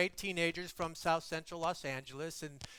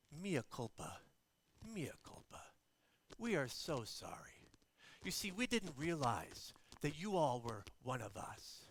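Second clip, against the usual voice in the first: soft, low, hushed confession; contrite apology; 5 semitones lower, 6 dB quieter than usual. Retold or imitated. Bishop confesses to the teenagers, soft and contrite. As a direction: apologize quietly, almost whispering.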